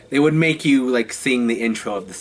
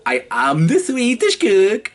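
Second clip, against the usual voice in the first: higher, louder mock Swedish chef accent; 9 semitones higher, 4 dB louder than usual. Shown as a demonstration. Actor shows his Swedish chef line, in a Swedish accent. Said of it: "I had to do a Swedish accent".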